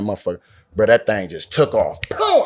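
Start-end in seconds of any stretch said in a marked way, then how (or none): 2.1-2.4 very high sudden "boom" sound effect; imitated burst of speed. Boar takes off running, high imitated boom. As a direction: pop it out high, like a launch.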